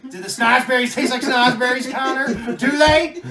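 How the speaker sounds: high, loud jeering call; mock taunting shout. Parent yelling at wall-licking kid, mock taunt. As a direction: holler it at the kid, mocking.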